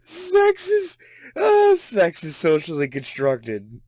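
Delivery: high squeaky laugh, slow. Real, not acted. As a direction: choke out the words mid-laugh. Laughing while reading claim.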